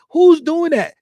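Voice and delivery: fired-up rhetorical question; emphatic demand. Real, not acted. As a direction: press the question hard. Rhetorical challenge to the viewer.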